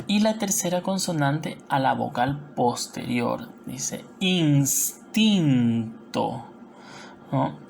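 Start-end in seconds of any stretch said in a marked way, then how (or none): 4.2-6.5 "instinto" drawn out slowly as ins, tin, to; split into syllables. Shown as a demonstration. Teacher reading out the example, "instinto" in slow syllables. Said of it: "cómo separamos instinto".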